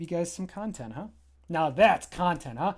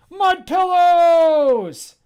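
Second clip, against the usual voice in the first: much higher, much louder, very slow drawn-out bellow; yell; 13 semitones higher, 14 dB louder, much slower than usual. Acted. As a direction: bellow it across the garden. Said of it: "And then he yells".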